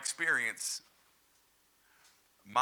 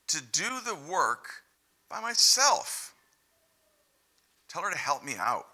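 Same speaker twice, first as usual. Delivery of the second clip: higher, louder pleading Martha; 4 semitones higher, 7 dB louder than usual. Acted. Martha asking the Lord for help, pleading. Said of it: "She came to him and asked".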